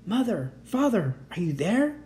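usual speaking voice

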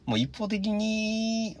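high, slow theatrical aside; mock dramatic voice. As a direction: stretch it out like a melodrama line, high.

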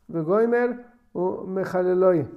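ordinary talk

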